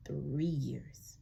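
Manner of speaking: hushed whispered three years. Real, not acted. Remembering three years in court, a whisper. Reflecting on long ordeal.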